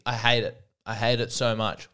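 ordinary delivery

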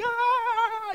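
high loud panicked shout; hammed-up terrified shout; mock panicked yell. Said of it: "They're like".

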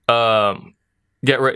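ordinary delivery

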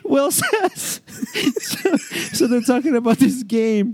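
high, squeaky voice after a laughing fit; still laughing. Real, not acted. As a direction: try to resume through leftover laughter.

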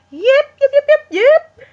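high, loud yips; yipping. Retold or imitated. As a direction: yelp it out high and loud like on a ride. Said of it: "and then we go".

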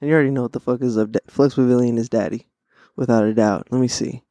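ordinary talk

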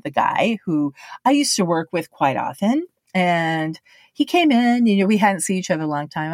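ordinary talk, nothing marked

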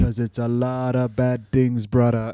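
low put-on wrestler voice; low, confiding wrestler impression; Jimmy Snuka. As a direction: drop low and menace lightly. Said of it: "Jimmy Snooker was like".

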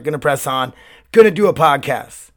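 ordinary delivery

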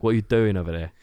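light Love Island-style British accent, chatty; lightly put-on casual British accent, teasing; friendly mock-British host with a light casual British accent. Shown as a demonstration. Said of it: "now it's the more like".

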